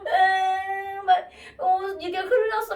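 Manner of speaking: mock wail over a spilled drink; retold crying child. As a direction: whimper it high like an upset kid. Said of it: "걔가 막 울어".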